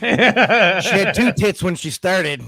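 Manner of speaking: laughing. Real, not acted. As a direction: crack up while delivering the joke. Joking line laughed through.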